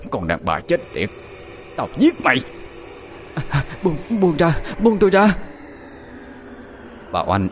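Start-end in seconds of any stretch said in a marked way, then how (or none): 0.1-2.4 teeth-gritted rage. A narrator's reading. Possessed man lunging at his sister-in-law, teeth-gritted rage. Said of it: "Ông nghiến răng nghiến lợi". 3.8-5.4 panicked plea. A narrator's reading. Woman being strangled by her brother-in-law, panicked cry.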